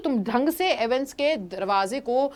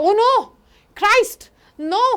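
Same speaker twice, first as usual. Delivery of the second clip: higher, louder gasp of horror; shocked cry; 8 semitones higher, 7 dB louder, much slower than usual. Acted. Warder seeing the bloodied man in the cell, shocked cry. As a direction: cry out at the sight.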